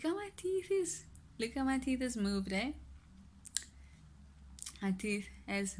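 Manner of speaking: talking with finger in mouth; lip pulled by finger. Real, not acted. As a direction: lips held apart by hand. Seen 3: Finger pulls lip down to show braces while she talks.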